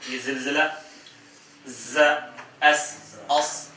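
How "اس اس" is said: اس with a long hissing s, slow; hissed s sound. Shown as a demonstration. Teacher demonstrating the whistling s of seen. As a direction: stretch the whistle of the s in اس. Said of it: "دیکھیں، اس".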